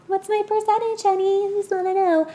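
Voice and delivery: high, sugary mock plea to honey; a wife coaxing her partner for her share; sweet-talking quote. Quoting Ezra's nagging question.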